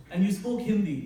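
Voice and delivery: ordinary talk, nothing marked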